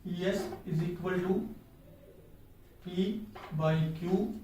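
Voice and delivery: formula read out slowly; slow teacher dictation of the formula. Shown as a demonstration. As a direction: dictate it word by word for notes. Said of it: "क्या करना है आपने".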